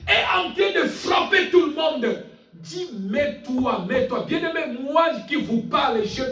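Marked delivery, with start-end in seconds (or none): none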